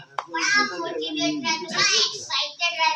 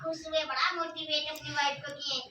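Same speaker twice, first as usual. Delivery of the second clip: ordinary delivery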